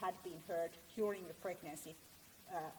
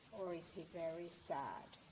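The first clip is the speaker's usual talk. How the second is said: slow, softer and drooping; sad voice; 2 semitones lower, 4 dB quieter, slower than usual. Shown as a demonstration. Lecturer demonstrating a sad voice to the audience, slow and heavy. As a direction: let it sag like someone very sad. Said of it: "how the person's voice sounds like".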